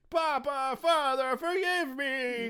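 high, slow pleading robot voice; broken robot crying. Retold or imitated. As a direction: beg your father like a dying machine. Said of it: "barely functioning Pino cries".

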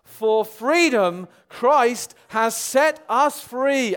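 loud, high, very slow verse; drawn-out, ringing declaration; leading a group reading. Shown as a demonstration. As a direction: proclaim it slowly so everyone reads along. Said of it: "Let's read that one more time".

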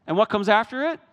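high, loud, driving sermon voice; full-force preaching. Acted. Preacher firing up the congregation about joy. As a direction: preach it out to the back row.

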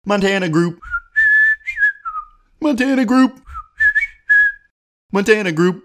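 high Muppet-style sing-song call; repeated high silly Muppet chant. Pretend. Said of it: "Whistle a normal business in a Muppet voice".

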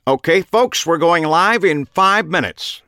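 loud, high host's announcement; projected, upbeat TV warm-up voice; smiling television host. A narrator's reading. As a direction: call out to the studio audience. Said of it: "the smiling television host told the assembled audience".

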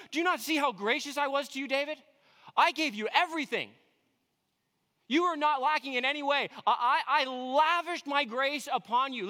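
high, loud, emphatic appeal as God; impassioned divine reproach. Acted. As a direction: plead your generosity to an ungrateful servant. Voices God reproaching David.

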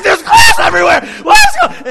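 loud, high-pitched hysterical yelling; screaming panic. Retold or imitated. Panicked passenger to driver after windshield smash, hysterical screaming. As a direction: act out a passenger losing it completely. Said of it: "He is just screaming".

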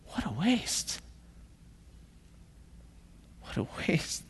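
very soft, aghast muttering; hushed disapproval. Acted. Those around Mary as she anoints Jesus, hushed disapproval. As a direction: mutter it under your breath, fading to a whisper.

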